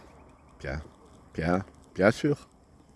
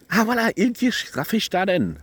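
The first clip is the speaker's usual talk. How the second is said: bursting out much higher as the name comes back; the aha moment of remembering a place name; sudden recall; 10 semitones higher than usual. Real, not acted. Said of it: "ça m'est revenu". (haut et soudain, ça lui revient)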